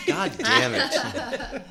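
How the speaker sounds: high, drawn-out exclamation with a laugh; delighted outburst. Real, not acted.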